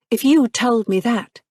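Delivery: cool and steady; simple reply. A narrator's reading. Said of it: "she replied simply".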